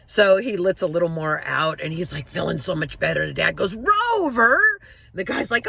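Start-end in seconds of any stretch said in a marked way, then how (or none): 3.7-4.6 dad calling the dog sharply, voice jumping higher. Retold or imitated. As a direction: scold the dog, a raised call. Dad scolds the dog again.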